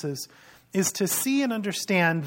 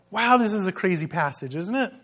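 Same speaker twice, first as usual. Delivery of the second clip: normal talking voice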